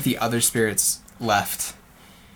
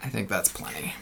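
whispering; 2 semitones lower, 8 dB quieter than usual. Real, not acted. Quiet aside during play.

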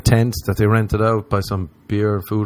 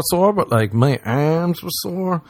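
ordinary talk

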